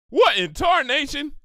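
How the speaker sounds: high, loud country exclaim; shocked exclaim. Acted. Applejack stunned by Pinkie's costume, loud high disbelief. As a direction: burst out in disbelief.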